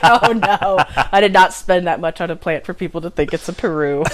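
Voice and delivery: loud indignant with a laugh. Retold or imitated. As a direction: protest it loudly, half laughing. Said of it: "and I'm like".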